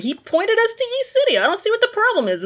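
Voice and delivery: high, fast mock-innocent voice; innocent teens. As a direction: rattle it off like clueless kids. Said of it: "they're like".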